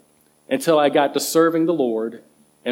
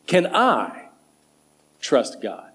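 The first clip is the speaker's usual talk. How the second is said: "I" raised and drawn out before a pause; stress on I; slower than usual. Shown as a demonstration. Preacher questioning his own faith, stress on "I". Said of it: "emphasis on the word or letter I".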